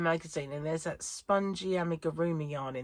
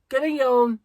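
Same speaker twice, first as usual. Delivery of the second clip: much higher and louder, gleeful; triumphant yawn; 8 semitones higher, 12 dB louder than usual. Retold or imitated. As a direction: belt it out like a victory. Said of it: "my body's going".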